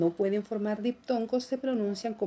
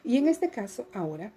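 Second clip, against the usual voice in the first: ordinary delivery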